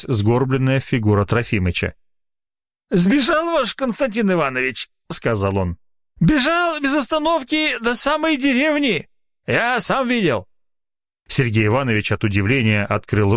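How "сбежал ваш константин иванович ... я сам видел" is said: put-on high old man's voice reporting; old watchman. A narrator's reading. Old watchman reporting the doctor's flight. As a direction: tell it like a gossiping old man.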